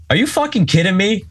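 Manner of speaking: high-pitched incredulous question; retold exasperated disbelief. Friend trying to sleep hears birds chirping, exasperated.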